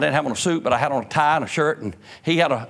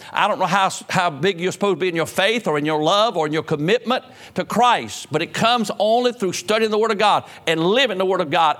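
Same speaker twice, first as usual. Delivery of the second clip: raised, ringing pulpit voice; preaching at full force; 7 semitones higher than usual. Acted. Pastor preaching hard to his Baptist congregation, full force. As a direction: preach it out to the pews.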